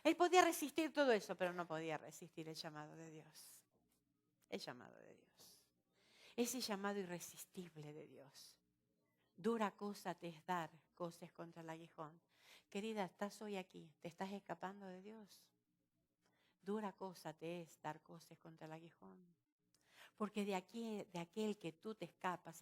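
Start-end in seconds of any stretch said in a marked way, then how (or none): 1.3-8.6 low, soft, reverent emphasis; solemn hush. Real, not acted. Preacher telling the congregation of God's call, solemn and hushed. 9.3-19.3 soft, low pastoral plea; tender appeal. Real, not acted. Preacher appealing to someone escaping from God, tender and hushed.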